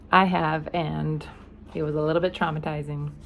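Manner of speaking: plain reading voice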